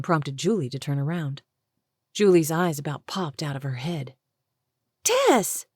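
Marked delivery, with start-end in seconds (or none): 5.0-5.7 startled cry. Acted. Caught in the act, startled.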